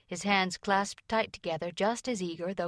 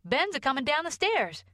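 put-on high-pitched, excited boy's announcement; excited scream; 8 semitones higher, 3 dB louder than usual. A narrator's reading. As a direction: blurt out big news in a high voice. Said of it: "screamed Joel at that moment".

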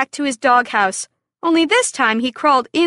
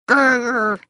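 dog growl. Acted. Dog won't budge from rooster.

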